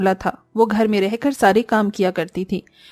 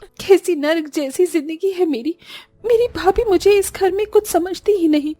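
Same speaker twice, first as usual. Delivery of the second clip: higher, mildly tearful voice; tearful lament; 7 semitones higher than usual. Acted. Disha alone in her room, a touch of crying. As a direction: pour out her misery alone. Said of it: "रोते हुए अपने कमरे में जाती है".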